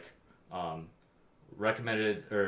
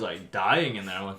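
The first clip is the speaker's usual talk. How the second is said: ordinary talk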